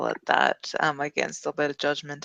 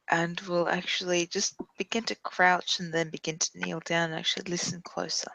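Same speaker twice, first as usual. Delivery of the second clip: ordinary delivery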